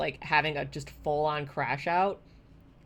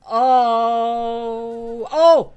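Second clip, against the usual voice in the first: loud, drawn-out startled "oh"; startled cry; 7 semitones higher, 12 dB louder than usual. Real, not acted. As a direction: cry out loudly, ending on a higher yelp. Sudden shock in the game.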